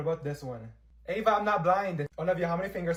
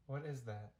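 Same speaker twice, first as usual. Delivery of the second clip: much softer, low and awkward; awkward quiet; 4 semitones lower, 13 dB quieter than usual. Acted. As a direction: mumble it, embarrassed. Awkward reaction to boyfriend.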